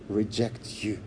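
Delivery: ordinary talk, nothing marked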